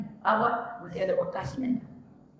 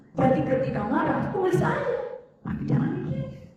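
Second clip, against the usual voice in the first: emphatic preaching. Acted. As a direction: drive the advice home. Preacher builds up the advice.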